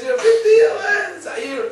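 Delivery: high laughing squeal of a line; amused, laughing through the words. Real, not acted. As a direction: laugh it out, pitch jumping way up. Laughs at his own example.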